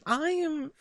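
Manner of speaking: usual speaking voice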